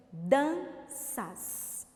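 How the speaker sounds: DANÇAS split as dan, ças; syllable by syllable. Shown as a demonstration. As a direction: say "Danças" in two beats with a clear pause between. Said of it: "Duas sílabas". ("Danças" separado em sílabas: dan, ças)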